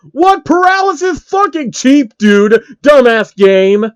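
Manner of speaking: furious yell. Real, not acted. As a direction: rage at a cheap game. Raging at the game.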